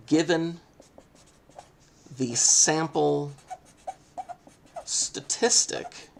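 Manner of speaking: slow, deliberate words for students to copy; slow emphatic dictation. Shown as a demonstration. Teacher dictating notes to students, slow and emphatic. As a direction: spell it out slowly as you write. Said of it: "I'm gonna underline this word".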